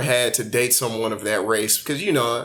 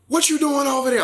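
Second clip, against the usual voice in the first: imitating her tone; 12 semitones higher than usual. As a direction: put on her fake urban tone, pitched way up. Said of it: "this tone".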